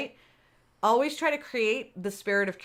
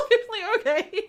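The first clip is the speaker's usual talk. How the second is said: laughing; 12 semitones higher, 5 dB louder than usual. Real, not acted. Laughing at self-convincing.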